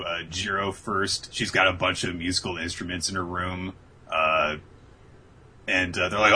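usual speaking voice

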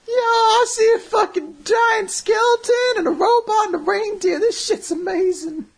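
high, loud, amazed stoner voice; stoned bear voice. Retold or imitated. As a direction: gush like a high teddy bear. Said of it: "The bear's like".

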